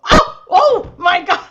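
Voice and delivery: high, loud "oh my God" gasps; imitating someone's loud entrance reaction; mock startled cry. Teasing a guest about how he walks in, mock startled cry. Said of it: "You come in the room and go".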